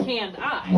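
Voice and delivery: canned I with a loud long eye at the end; mispronounced candy. Shown as a demonstration. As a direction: deliberately say candy as can-DIE. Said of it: "We don't ever say canned I".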